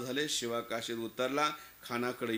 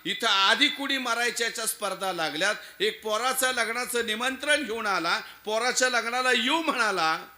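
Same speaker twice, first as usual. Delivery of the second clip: raised voice, high and loud; impassioned explanation; 8 semitones higher, 6 dB louder than usual. Acted. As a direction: explain it fervently to your king. Servant explains why he volunteered.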